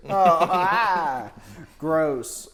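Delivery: loud grossed-out laugh; disgusted laugh. Real, not acted. Reacts to a gross movie detail.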